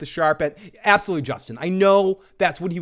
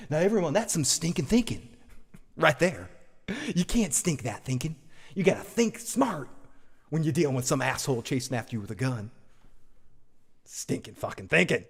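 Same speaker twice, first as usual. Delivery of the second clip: inspirational speaker; 7 dB quieter than usual. Pretend. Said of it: "let me talk like a, an inspirational thing".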